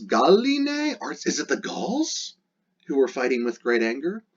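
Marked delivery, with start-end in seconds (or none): 0.0-1.0 very high questioning pitch on Galline; questioning lilt. Shown as a demonstration. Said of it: "If you decide to make it the Gauls".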